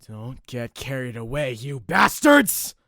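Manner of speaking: furious yell; sudden burst of rage. Acted. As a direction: build to a huge, drawn-out shout. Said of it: "his sudden burst of rage".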